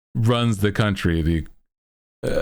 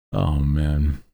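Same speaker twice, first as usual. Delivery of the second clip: usual speaking voice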